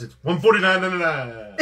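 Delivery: loud, punchy sales pitch; car salesman pitch. Shown as a demonstration. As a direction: sell it like a used car ad. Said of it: "I slowed it down so they can understand me".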